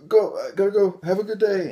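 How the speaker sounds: high, nervous excuse-making voice; panicked intruder. Retold or imitated. Intruder at gunpoint babbling an excuse, high and panicky. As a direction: stammer the excuse high and jittery, then rush to leave. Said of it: "It's like".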